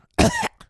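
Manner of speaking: sharp high hacking cough; harsh cough. Real, not acted. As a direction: cough it out hard. Sick streamer coughing.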